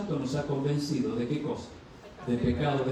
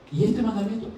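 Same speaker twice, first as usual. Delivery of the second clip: higher, pointed challenge; raised questioning voice; probing question; 8 semitones higher, 4 dB louder than usual. Acted. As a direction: press him about a commandment he missed. The law voiced questioning sinner.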